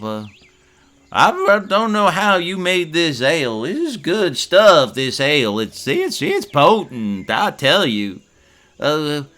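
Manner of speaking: high, loud hearty farmer voice; barley farmer. Acted.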